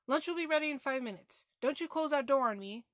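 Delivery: acted-out yell from another room; mom calling out. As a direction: call sternly to a child heading off to their room.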